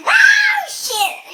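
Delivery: high, loud cursing shout cracking into a laugh; hammed-up crash scream; panicked yell. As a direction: yell in mock terror as the car rolls. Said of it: "And I'm going".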